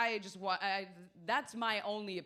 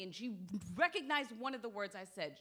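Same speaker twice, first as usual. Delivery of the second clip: ordinary talk, nothing marked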